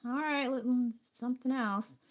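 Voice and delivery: mock-patient brush-off, changing the subject; exasperated brush-off. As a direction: wave it off like you're tired of hearing it. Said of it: "It's like".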